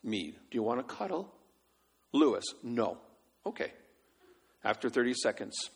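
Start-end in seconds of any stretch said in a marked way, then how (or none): none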